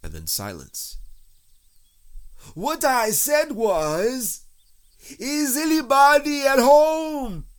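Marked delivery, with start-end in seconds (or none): 2.5-7.5 high, slow, emphatic holler; insistent loud bear call. A narrator's reading. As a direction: repeat it at full volume, word by word. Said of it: "called out Pooh very loudly".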